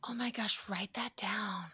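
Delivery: hushed, voiceless aside; whispering. Real, not acted. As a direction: whisper it like a secret. Aside after a gasp.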